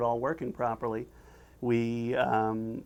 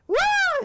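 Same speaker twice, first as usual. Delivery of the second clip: piercing, very high loud shriek; cartoon scream; 7 dB louder than usual. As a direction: scream at full pitch like a terrified cartoon. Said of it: "It's still going on".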